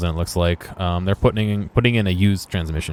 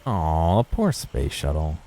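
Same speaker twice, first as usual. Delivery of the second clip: sympathetic; 6 semitones lower, 5 dB quieter, much slower than usual. Real, not acted. Pities the graffitied shuttle.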